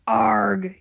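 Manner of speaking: loud, drawn-out pirate arg; crew's yell. A narrator's reading. Crew yelling at the giant wave, loud arg. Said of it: "yells the crew".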